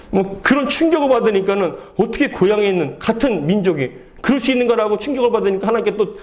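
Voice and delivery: ordinary delivery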